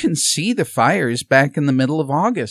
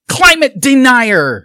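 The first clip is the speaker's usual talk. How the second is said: high, loud, slow accusing jeer; mock accusation; 9 semitones higher, 8 dB louder, slower than usual. Activists jeering at the candidate, high loud accusing. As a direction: sneer the label at him. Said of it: "and then I'd get".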